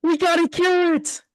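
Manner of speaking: high, loud urgent yell; frantic high shout; panicked. Acted. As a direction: shout the decision in terror. Frank answers in panic.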